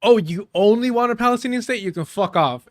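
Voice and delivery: a scornful send-up of the guest; mocking quote. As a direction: parody the guest to mock him. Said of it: "is what he said".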